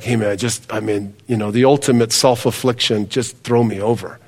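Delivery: low, soft voicing of a guilt-ridden Jonah. Pastor voicing Jonah asking to be thrown over, resigned. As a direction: mutter it like a man who has given up. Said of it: "So he's just like".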